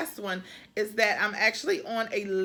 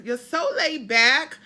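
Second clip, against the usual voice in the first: put-on slow, loud New England accent, rising at the end; 3 semitones higher, 8 dB louder than usual. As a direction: imitate a New Englander, stretch it out. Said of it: "do my New England accent".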